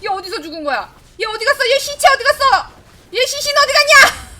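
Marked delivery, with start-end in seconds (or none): 1.2-4.3 rising, high, loud disbelieving complaints; loud, high exasperation with a laugh; exasperated. Real, not acted. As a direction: berate the teammate for dying there. Ally died somewhere baffling.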